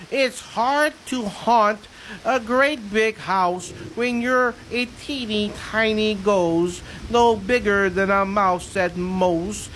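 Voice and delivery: slow storybook reading; reading to children. Acted. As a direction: read it gently like a bedtime poem. Reading ghost poem.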